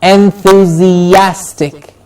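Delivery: "Enthusiastic" slowly, every vowel sounded; drawn-out en-thu-zi-AS-tic; taking time. Shown as a demonstration. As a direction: say "Enthusiastic" stretched syllable by syllable. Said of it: "Take your time with the word".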